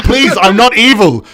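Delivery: mock protest. Acts exasperated protest at being doubted.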